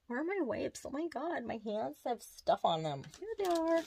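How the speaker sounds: high-pitched singsong chatter; high, playful little-voice fussing. Real, not acted. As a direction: sing out while hunting for something. Singsong hunt for her wipes.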